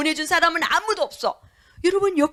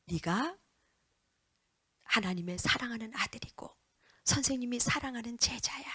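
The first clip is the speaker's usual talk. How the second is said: put-on soft, hushed words of love to a child; tender whisper; 3 semitones lower, 11 dB quieter than usual. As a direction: whisper it like a blessing. 아이에게 사랑을 속삭임. (아이에게 속삭이는 다정한 목소리)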